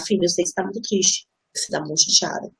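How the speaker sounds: soft, low, tender comfort to a daughter; soothing mother. Retold or imitated. Mother comforting her upset daughter, soft and tender. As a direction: soothe her gently, voice dropped. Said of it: "E das costas, e encostar".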